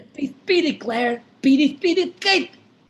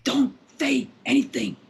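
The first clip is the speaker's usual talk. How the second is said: hushed unvoiced warning; whisper. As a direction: breathe it urgently. Intruder whispers.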